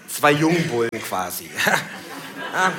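ordinary delivery

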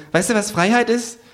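high kangaroo voice, explaining; lecturing kangaroo. Acted. Kangaroo explaining capitalism to narrator, high lecturing.

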